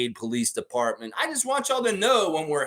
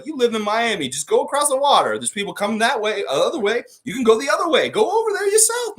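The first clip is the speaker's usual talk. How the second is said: mocking; 11 semitones higher, 6 dB louder than usual. Real, not acted. Mocking the ref, rising pitch.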